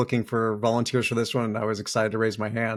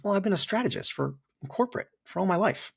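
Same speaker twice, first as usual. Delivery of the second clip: much higher, wondering tone; mock self-questioning; 8 semitones higher than usual. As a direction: realize it half amused. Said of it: "I was".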